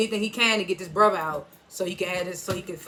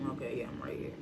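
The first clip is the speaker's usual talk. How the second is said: muttered to self under breath; whispered aside; 9 semitones lower, 14 dB quieter than usual. Real, not acted. Finding his place in his notes, whispering to himself. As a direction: whisper it to yourself while finding your place.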